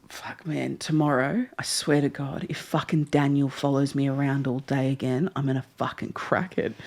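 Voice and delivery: muttered, hushed angry rant in bed at night; whispered fury about Daniel in the dark. Retold or imitated. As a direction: grumble it low so nobody else hears. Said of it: "This guy turns to his mate and goes".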